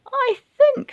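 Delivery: put-on very high old lady voice; grandma voice. A narrator's reading. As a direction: squeak it like a granny. Grandma speaking.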